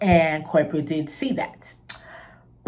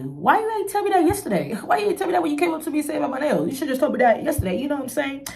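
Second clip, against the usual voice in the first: higher, fast, exasperated questioning; indignant rant; 8 semitones higher, faster than usual. Real, not acted. Ranting about the manager firing her, high and indignant. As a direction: demand to know why he waited.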